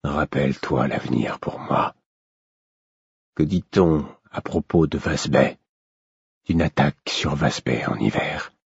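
low, soft rasping demand; hoarse voice. A narrator's reading. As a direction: rasp it quietly, low. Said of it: "d'une voix rauque".

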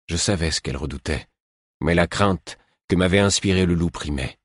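usual speaking voice

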